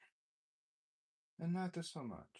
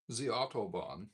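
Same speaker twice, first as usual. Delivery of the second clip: German accent; 5 dB louder than usual. Shown as a demonstration. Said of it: "Preferably with a strong German accent".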